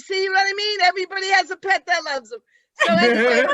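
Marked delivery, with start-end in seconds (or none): 2.7-3.5 laughing. Real, not acted. Laughing at own joke.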